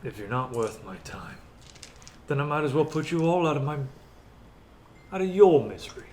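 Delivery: a hint of a menacing lord; menacing villain. Villain threatening Goo's party, lightly voiced menace. Plays villain answering Goo.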